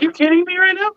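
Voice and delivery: high, incredulous outburst; you can't believe what she asked; disbelief. Retold or imitated. Dad stunned by his daughter's request, high and incredulous.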